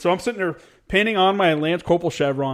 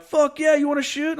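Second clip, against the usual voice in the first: eager mock invite; 12 semitones higher than usual. Marines hyping up the new guy to shoot, excited. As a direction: ham up the buddies egging him on.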